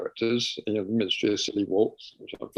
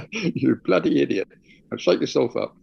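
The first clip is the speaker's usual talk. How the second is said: self-scolding; 3 semitones higher, 5 dB louder than usual. Retold or imitated. Scolding himself.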